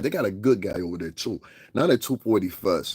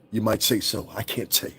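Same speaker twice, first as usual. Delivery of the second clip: lightly put-on articulate singer voice; mock nineties video act; 3 dB quieter than usual. Imitating the singer's nineties video move, lightly affected voice. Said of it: "So what he did was, like, a classic".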